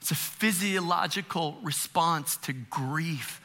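usual speaking voice

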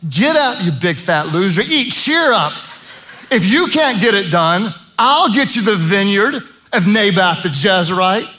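high, sneering taunt at a whiny husband; mock him like a contemptuous queen; scornful Jezebel. Jezebel scolding sulking Ahab, high sneering taunt. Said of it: "I've just heard it said this way".

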